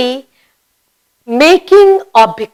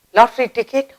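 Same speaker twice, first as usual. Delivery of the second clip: usual speaking voice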